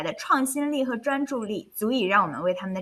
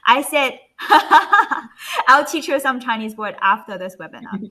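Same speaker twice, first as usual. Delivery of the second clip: laughing; 3 semitones higher, 7 dB louder than usual. Real, not acted. Host repeating a joke offer to the presenter, laughing. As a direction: talk through real laughter.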